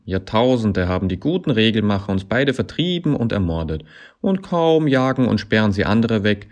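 loud, high, swooping mock agreement; sarcastic tirade. A narrator's reading. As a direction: rant with scornful emphasis. Sarcastic tirade in acted quarrel.

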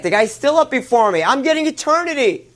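very high, indignant outburst; exasperated protest. Acted. Scholar outdone, protests more strongly.

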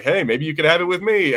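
ordinary delivery

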